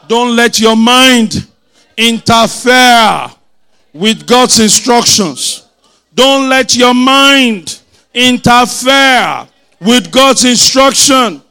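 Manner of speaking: slow, loud, drawn-out exhortation; forceful preaching. Real, not acted. Preacher giving words to tell your neighbor, forceful preaching. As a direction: stretch each phrase for them to repeat.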